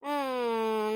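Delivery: low sulky hum with closed mouth; a low huffy hmph of refusal. Acted. Grandmother refusing to answer her grandson, low sulky hum.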